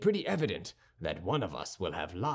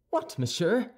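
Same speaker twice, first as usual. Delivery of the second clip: high, startled question; indignant cry; 9 semitones higher than usual. A narrator's reading. D'Artagnan to Aramis when rebuked, crying out. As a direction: cry it out, pitched well up. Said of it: "cried D'Artagnan".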